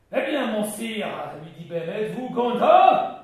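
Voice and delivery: seek the master's praise, loud; proud question. Acted. Ben asking his lord whether he is pleased, loud and proud.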